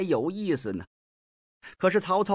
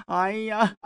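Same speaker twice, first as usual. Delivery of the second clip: higher, sing-song sigh of grief; mock lament; 4 semitones higher than usual. Mi Heng answers Xun Yu with a mock lament. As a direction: sigh it like an opera mourner. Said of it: "哭什么劲儿啊".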